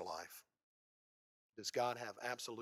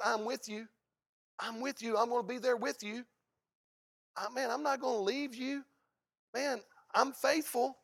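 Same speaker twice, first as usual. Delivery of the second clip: earnest reassurance; 11 semitones higher, 8 dB louder than usual. Acted. Jesus answering the believer.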